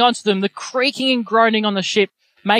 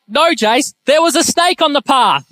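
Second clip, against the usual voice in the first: high, louder alarmed warning; retold panicked warning, higher and louder; urgent alarm; 7 semitones higher, 3 dB louder than usual. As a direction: tell your friend about the snake with urging. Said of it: "And I was like".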